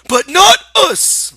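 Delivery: high, loud, slow joyful cry; overjoyed outburst; happy cry. Acted. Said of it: "Lenny cried happily".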